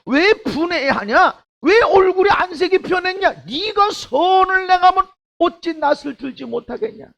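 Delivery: high, loud rebuking voice; God rebuking Cain. Acted. Pastor voices God scolding angry Cain, loud and high. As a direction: thunder God's rebuke down on him. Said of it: "하나님이 뭐라 그럽니까?".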